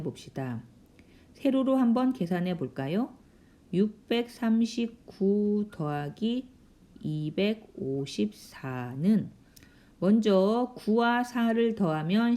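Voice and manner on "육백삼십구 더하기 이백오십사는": slow, drawn-out reading while writing; measured pace, number by number; slow dictation. Real, not acted. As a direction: stretch out each number as you write it. Reads problem while writing it vertically.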